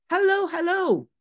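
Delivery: the parrot calling down again; calling bird. Acted. Teacher voicing the bird calling down to Mathilda. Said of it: "L'oiseau, euh, criait down".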